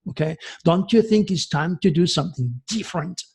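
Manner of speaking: ordinary delivery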